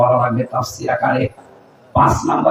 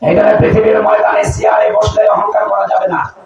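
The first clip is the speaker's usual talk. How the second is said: high, sustained preacher's cry; impassioned preaching; 7 semitones higher than usual. Real, not acted. Preacher warning listeners against pride in office, impassioned preaching. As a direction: drive the warning home at high pitch.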